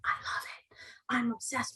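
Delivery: hushed, voiceless aside; adoring whisper. Real, not acted. Host gushing over bookmarks to viewers, adoring whisper. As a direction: whisper it, smitten.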